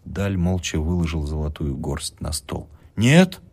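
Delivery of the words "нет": sharp high refusal; firm no. A narrator's reading. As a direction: refuse her loudly. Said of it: "замотала головой женщина". (громкое резкое «нет»)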